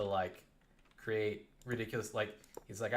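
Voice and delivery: usual speaking voice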